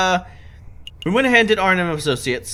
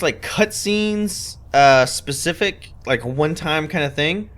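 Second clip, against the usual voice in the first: usual speaking voice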